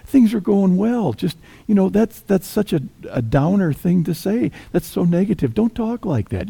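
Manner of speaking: high, anxious pleading friend; pleading Peter. Retold or imitated. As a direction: beg him not to talk of death, voice up high. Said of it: "in my mind it went something like this".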